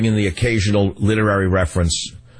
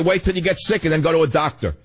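mocking official; 6 semitones higher than usual. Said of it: "He'll tell you".

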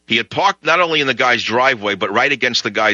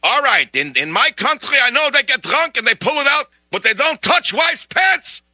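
increasingly high, indignant mock Russian accent; imagined Russian; 12 semitones higher than usual. Russian explains his country's limits, indignant high accented voice. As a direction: climb into an outraged Russian caricature voice. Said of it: "It's like".

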